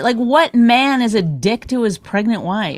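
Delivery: ordinary talk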